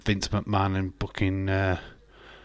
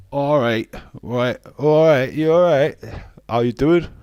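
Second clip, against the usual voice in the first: put-on Yorkshire accent; 4 semitones higher, 7 dB louder than usual. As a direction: greet everyone in hammed-up broad Yorkshire. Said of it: "my Yorkshire accent would so stand out".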